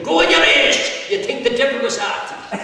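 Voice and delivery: high, loud shout at a crowd; priest shouting. Acted. Priest to people rushing out of the chapel, shouting. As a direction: yell it over a rushing congregation. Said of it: "And the priest shouting".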